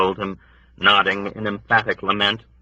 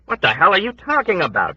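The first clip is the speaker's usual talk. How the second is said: bewildered shout; 11 semitones higher than usual. A narrator's reading. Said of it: "shouted at her in bewildered, furious protest".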